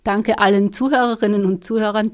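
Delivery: normal talking voice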